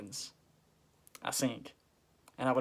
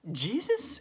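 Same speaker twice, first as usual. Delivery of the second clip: high, rising disbelief; acted amazed recognition; astonished question; 10 semitones higher, 4 dB louder than usual. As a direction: gasp the name as a question. Acts Peter recognising Jesus.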